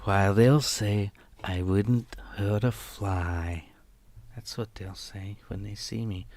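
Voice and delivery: slow, hushed, measured delivery; softly and slowly, drawing out each phrase; eerie film monologue. Acted. Streamer reciting what they'll say of them, slow and soft.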